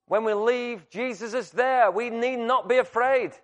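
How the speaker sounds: high, fervent preaching voice; impassioned preaching. Real, not acted. Preacher assuring congregation that Jesus loves them, fervent preaching. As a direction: lift the voice and press the assurance home.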